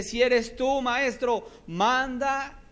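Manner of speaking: high, loud plea; eager Peter. Acted. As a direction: call out boldly. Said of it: "Pedro le dice".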